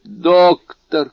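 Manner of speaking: high, loud cry for the doctor. A narrator's reading. Dying servant to the doctor, crying out. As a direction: call out desperately, high and loud. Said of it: "кричал Баруа".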